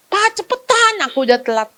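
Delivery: put-on high, pleading kid rushing dad; urgent child. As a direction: whine at dad to hurry.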